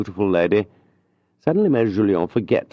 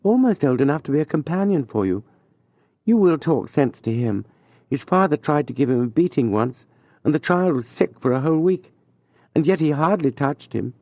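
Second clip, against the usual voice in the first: higher, lightly voiced lady, relieved and chatty; a mother at ease now, pitched up; reassured lady; 8 semitones higher than usual. A narrator's reading. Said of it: "totally reassured".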